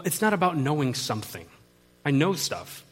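ordinary talk

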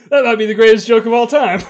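loud, high delighted laughter; laughing loudly. Real, not acted. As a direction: roar with laughter at the joke. Still laughing.

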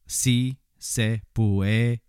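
very slow, clear Spanish demo; patient, slow model pronunciation of "Si se puede"; said slowly. Shown as a demonstration. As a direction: spell it out syllable by syllable. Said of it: "You could say it slow".

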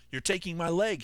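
loud high cry of alarm; a soldier shouting at his surgeon. Acted. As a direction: shout it out in horror. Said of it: "he shouted".

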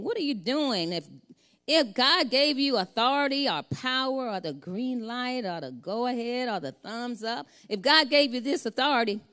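lightly voiced leaders pressing Jesus for proof.

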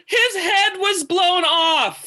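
very high, drawn-out indignant retelling; outraged disbelief. Retold or imitated. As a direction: re-enact an appalled comeback, slow and high. Outraged comeback in retold argument.

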